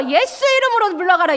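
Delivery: loud, high proclamation against the devil; commanding rebuke. Shown as a demonstration. Preacher shows the congregation how to rebuke the devil, loud and commanding. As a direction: rebuke it with full authority.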